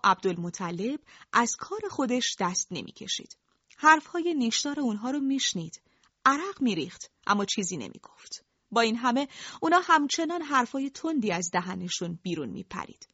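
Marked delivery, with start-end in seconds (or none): none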